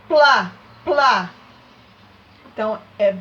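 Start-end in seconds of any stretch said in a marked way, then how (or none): 0.1-1.3 plá loud, P and L joined to the A; sounding out plá. Shown as a demonstration. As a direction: punch plá twice for the class. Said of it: "saiu o som do L junto com o A".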